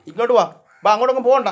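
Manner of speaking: loud, high call to a straying pet; calling back. Real, not acted.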